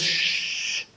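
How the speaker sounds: long, voiceless "shh"; drawn-out shush. Acted. Imam silencing a flatterer, long hushing sound. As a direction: hush the praise with a long shush. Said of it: "He says".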